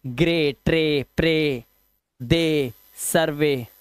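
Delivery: word by word, hold "ay" in ग्रे, ट्रे, सर्वे; "ay", not "ee". Shown as a demonstration. Teacher reading exception words, low long "ay" endings on ग्रे and सर्वे. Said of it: "उच्चारण अब ई नहीं".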